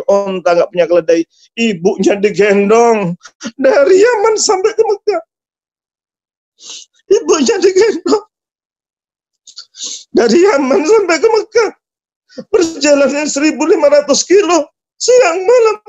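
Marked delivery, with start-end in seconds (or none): 3.6-5.2 very high, tearful voice; choked with emotion. Real, not acted. Preacher marvels at son carrying mother to Mecca, choked up. 7.1-8.3 high, sobbing voice; crying. Real, not acted. Preacher moved by son carrying his mother, crying. Tearful repeat of the same words. 10.1-15.8 high, tear-choked wail; crying. Real, not acted. Preacher recounts son's long journey carrying mother, crying.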